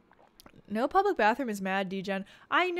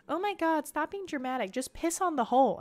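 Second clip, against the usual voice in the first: higher-pitched mock exasperation; imitated exasperated brother; 6 semitones higher than usual. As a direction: mimic a brother fed up with the fuss. Voices brother's exasperated reply to her.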